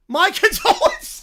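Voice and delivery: can't keep a straight face; laughing through it. Real, not acted. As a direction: giggle breaking into the word. Reply cracks up mid-line.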